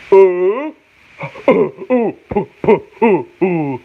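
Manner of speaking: high, repeated "oh" sounds; mock classroom sounding-out of "oh"; phonics drill. Shown as a demonstration. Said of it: "That's how they teach you how to read".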